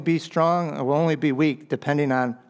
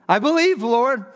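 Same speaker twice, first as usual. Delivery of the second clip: high, loud burst on I believe; bold cry of faith; 9 semitones higher, 3 dB louder than usual. Acted. Dad declaring his faith to Jesus, a high loud cry. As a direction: cry it out boldly. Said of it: "Such a bold declaration of faith".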